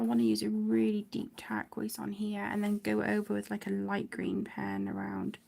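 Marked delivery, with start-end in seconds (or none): none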